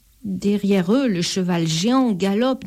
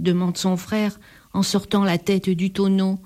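usual speaking voice

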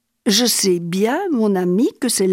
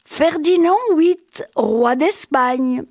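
appel crié; 6 semitones higher, slower than usual. A narrator's reading. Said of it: "il cria".

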